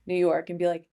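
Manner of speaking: usual speaking voice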